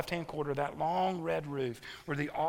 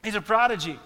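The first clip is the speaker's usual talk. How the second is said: put-on raised, louder wonder at the boy; mock the crowd's astonishment, louder; louder amazed exclamation; 5 semitones higher, 8 dB louder, faster than usual. Crowd marvelling at the young Jesus, raised astonished voice. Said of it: "their way of going".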